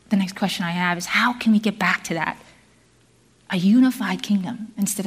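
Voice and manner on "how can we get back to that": usual speaking voice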